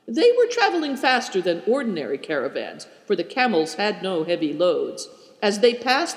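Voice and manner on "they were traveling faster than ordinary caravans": ordinary delivery, nothing marked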